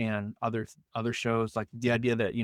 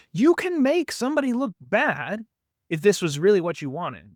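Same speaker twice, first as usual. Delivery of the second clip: high, loud indignant protest; indignant outburst; 8 semitones higher, 5 dB louder than usual. Real, not acted. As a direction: raise your voice in indignation.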